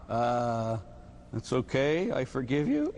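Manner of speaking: hesitant, lightly voiced forgiveness; a hint of a beaten victim; reluctant victim. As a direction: forgive him haltingly.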